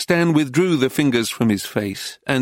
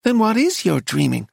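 high-pitched, pressing question; baffled American wife, pitched up; exasperated; 8 semitones higher than usual. A narrator's reading. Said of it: "in an exasperated tone".

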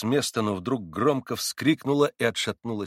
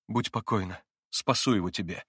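plain reading voice

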